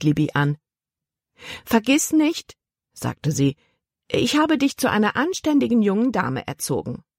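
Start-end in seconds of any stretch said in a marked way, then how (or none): 1.6-2.6 high-pitched, insistent reminder; admonishing aunt. A narrator's reading. Stern aunt admonishes her niece. 4.1-7.0 higher-pitched, prim reminder; admonishing aunt. A narrator's reading. Stern aunt admonishes her niece.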